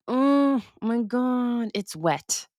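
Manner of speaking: high, drawn-out mock dismay; retold dismay. Traveller finding her purse soaked, retold drawn-out dismay. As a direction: stretch it out high, as if just discovering a mess.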